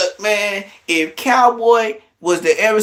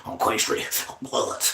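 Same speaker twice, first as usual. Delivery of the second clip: whispered, slow mock chant of his gang slogan; a mocking whispered on Queen Street on blood; 7 semitones lower, 11 dB quieter than usual. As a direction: hiss it low like a secret oath. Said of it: "I'm be like".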